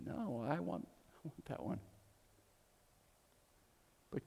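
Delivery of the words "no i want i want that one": soft whisper, much quieter; hushed, tender whisper recalling the boy; whispered boy's line. Retold or imitated. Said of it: "To look at it and say".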